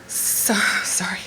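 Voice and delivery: breathless, whispered, slowed sorry; whispered apology. Real, not acted. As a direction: whisper it as emotion rises. Speaker choking up mid-speech.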